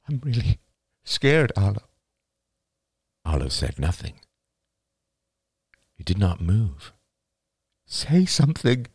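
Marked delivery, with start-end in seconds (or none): 0.1-1.8 higher, on the verge of tears; tearful, scared. A narrator's reading. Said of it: "eyes suddenly filled with tears". 7.9-8.9 higher-pitched, urgent plea; pleading. A narrator's reading. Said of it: "she pleaded".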